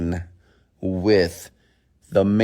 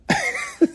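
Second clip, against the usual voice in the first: short burst of laughter; laughing. Real, not acted. Laughs after retold compliment.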